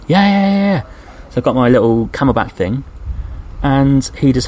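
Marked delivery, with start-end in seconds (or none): 0.1-0.8 high, loud, eager yes-yes-yes; overjoyed acceptance of the hose; elated yes. Retold or imitated. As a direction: grab the offer with delight. Relieved when offered water.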